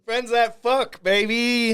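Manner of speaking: high, loud hype; hyped shout. Real, not acted. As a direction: shout it like a party chant. Hyped shout after whoops.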